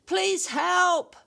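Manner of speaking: high, loud desperate plea for help; panicked cry. Acted. Cheetah swept downstream calls for help, panicked. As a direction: scream it out, terrified of drowning. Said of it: "cried the cheetah in panic".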